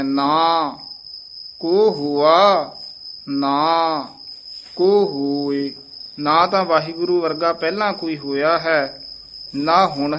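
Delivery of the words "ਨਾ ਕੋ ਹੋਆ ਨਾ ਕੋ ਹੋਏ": ਨਾ stressed with a pause, not ਨਾਕੂ; slow stressed recitation; stressed ਨਾ, split from ਕੋ. Shown as a demonstration. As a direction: separate ਨਾ from ਕੋ with weight. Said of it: "ਇੱਥੇ ਯਮਕ ਲਾ ਦੇਣੀ ਹੈ".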